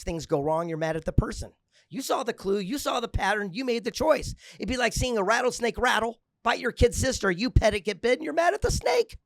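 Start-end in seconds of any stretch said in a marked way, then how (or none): 1.9-9.2 higher and louder with each phrase; rising exasperated crescendo; building intensity. Real, not acted. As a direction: build to a peak. Motivational speech building up.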